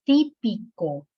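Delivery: típico drawn out as TÍ, pi, co; slow classroom split of típico; syllable-by-syllable demo. Shown as a demonstration. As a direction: sound out típico slowly, stressing TÍ.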